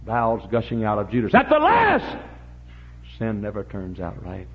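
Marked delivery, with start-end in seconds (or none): 1.3-2.2 high, loud preacher's cry; shouted refrain. Real, not acted. Preacher warning sinners of the end, sudden shout.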